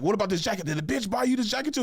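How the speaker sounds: high, lightly voiced jealous girlfriend; a hint of an accusing ex; high mock-suspicious question.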